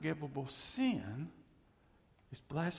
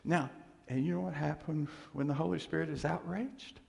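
ordinary talk

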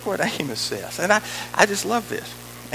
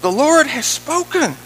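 higher, louder proclamation; declaimed scripture; 6 semitones higher, 7 dB louder than usual. Acted. Preacher quotes Amos on prophesying, declaiming. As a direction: proclaim it forcefully and unhurried.

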